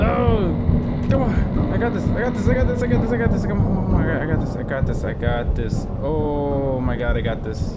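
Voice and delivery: loud, high, strained panic, easing toward the end; frantic self-urging. Real, not acted. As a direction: push yourself through fear, pumping yourself up. Crawling away from monster.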